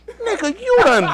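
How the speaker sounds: very high-pitched mock outrage; incredulous officer. Retold officer catches him out, high incredulous exclamation.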